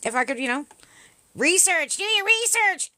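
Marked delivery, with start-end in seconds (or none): 1.3-2.9 high, loud sneering parody; mocking the convoy crowd, pitched way up; mocking convoy guy. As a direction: mimic a trucker conspiracy type. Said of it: "the word that uses by a honk honk".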